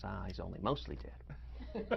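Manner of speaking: put-on slow, soft, chuckling old healer; Billy Crystal's Miracle Max. Miracle Max judging Wesley on the table, slow and soft. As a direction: let a laugh creep into the verdict. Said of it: "Billy Crystal explains".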